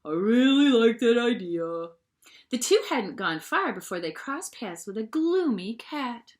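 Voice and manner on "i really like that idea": friendly dog voice. A narrator's reading. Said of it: "said the dog".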